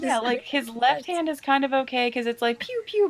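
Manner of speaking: laser pew sounds. Pretend. Imitating laser gun sounds.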